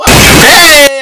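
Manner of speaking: loud, high hey; shouted greeting. Real, not acted. Vlog intro greeting shout.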